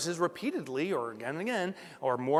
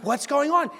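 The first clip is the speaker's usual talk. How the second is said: confused; 8 semitones higher, 5 dB louder than usual. Retold or imitated. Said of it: "I'm like".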